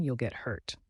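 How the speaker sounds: ordinary delivery, nothing marked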